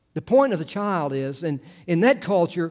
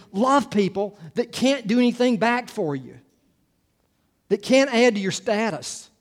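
preaching; 3 semitones higher, 5 dB louder than usual. Acted. Preacher pressing the point.

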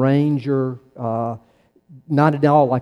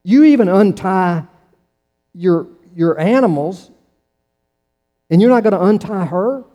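indignant raised challenge; 5 semitones higher, 5 dB louder than usual. Retold or imitated. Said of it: "Jesus says".

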